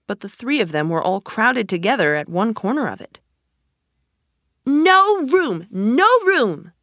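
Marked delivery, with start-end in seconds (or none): 4.6-6.7 loud, high cries of protest; crying out. A narrator's reading. Tea party crying out as Alice comes, loud and high. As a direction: shout the newcomer away, high-pitched. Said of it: "they cried out".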